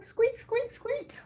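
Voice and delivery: very high squeaky imitation of a toy; imitating the squeaker. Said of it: "You got the squeaker going?".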